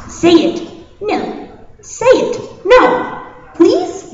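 high, loud back-and-forth nagging; hammed-up childish squabble; insistent bickering. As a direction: badger and refuse, pitch climbing.

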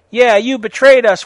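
loud, high theatrical accusation; mock angry shout. A narrator's reading. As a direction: shout it with overacted anger. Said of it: "shooted Vampire angrily".

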